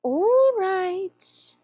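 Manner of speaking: slow, purring Cheshire Cat voice; slow drawling cat. Acted. Grinning cat teasing the lost girl, slow and drawn out. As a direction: stretch each word like a lazy cat.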